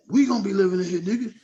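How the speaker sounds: loud, high crowing with a laugh; exuberant boasting. Retold or imitated. [name] showing off the house he just bought, exuberant boasting. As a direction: imitate a gleeful shouted brag.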